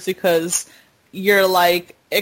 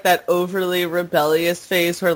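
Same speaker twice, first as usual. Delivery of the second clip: usual speaking voice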